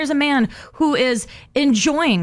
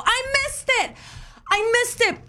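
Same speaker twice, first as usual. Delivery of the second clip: much higher, softer whine; whiny lament; 13 semitones higher, 4 dB quieter than usual. Real, not acted. Wife whining to husband about missing her drink.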